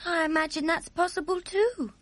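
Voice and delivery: put-on high, small girl voice asking; a young girl's puzzled question; curious little girl. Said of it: "Sophie said".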